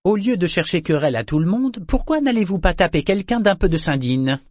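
high-pitched sarcastic suggestion. A narrator's reading. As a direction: needle them with a mocking question. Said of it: "demanda Althea d'un ton sarcastique".

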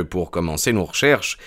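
usual speaking voice

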